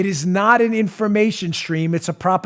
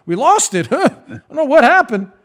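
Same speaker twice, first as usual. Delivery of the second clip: mock excuse; 8 semitones higher, 5 dB louder than usual. Mocking officials' excuse.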